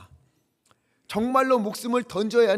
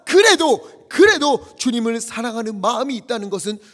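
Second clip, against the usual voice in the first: loud, high, fervent preaching; pleading for the congregation's confession; preaching climax; 6 semitones higher, 9 dB louder than usual. Acted. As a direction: build to a passionate peak.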